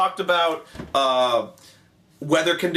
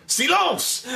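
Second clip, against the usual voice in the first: high-pitched mock command; hammed-up commander demanding silence; Napoleon; 13 semitones higher than usual. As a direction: pitch it way up, an imperious order. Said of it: "he's like".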